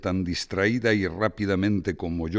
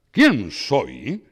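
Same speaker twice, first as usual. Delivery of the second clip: gleeful shout; 6 dB louder than usual. Acted. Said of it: "gritaba alborozado".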